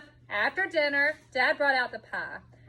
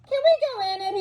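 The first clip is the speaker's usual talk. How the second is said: put-on eager boy voice; 8 semitones higher than usual. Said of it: "he asked".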